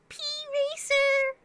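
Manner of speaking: loud, drawn-out high squeal; piercingly high playful cry; very high-pitched. Pretend. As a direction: call it out way up high, slowly. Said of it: "in a very high-pitched voice".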